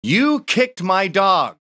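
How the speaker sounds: loud, high, slow yell; angry dog owner yelling slowly; retold yell. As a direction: bellow it out slowly. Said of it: "he yells".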